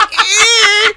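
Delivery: high, loud straining grunt; strained grunt. Retold or imitated. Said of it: "you're like".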